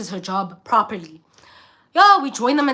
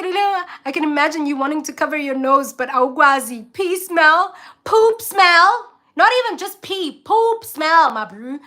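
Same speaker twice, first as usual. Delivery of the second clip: animated retelling; 5 semitones higher, 5 dB louder than usual. Retold or imitated.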